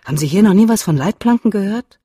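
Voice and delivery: put-on exasperated teen complaint; exasperated outburst. A narrator's reading. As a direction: vent at the missing guardrails. Son exasperated at the road.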